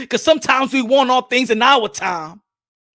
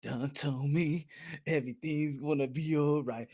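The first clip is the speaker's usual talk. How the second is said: mock preacher; 10 semitones lower, 18 dB quieter than usual. Preacher voice after song.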